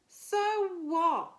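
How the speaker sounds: high, loud scoff; dismissive. A narrator's reading. Brothers and sisters scoff at Spinderella, high loud retort. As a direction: throw it back like scornful siblings. Siblings scoff at her counting.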